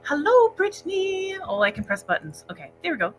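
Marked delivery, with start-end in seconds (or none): 0.0-1.3 calling out a bright, high hello; high excited greeting. Real, not acted. Greeting Brittany in the chat, high sing-song hello. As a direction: sing it out much higher, drawn slowly.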